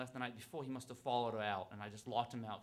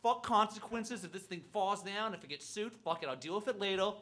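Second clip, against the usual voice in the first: determined shout; 6 semitones higher, 7 dB louder than usual. Retold or imitated. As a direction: charge the gate, all-in and loud. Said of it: "I'm like".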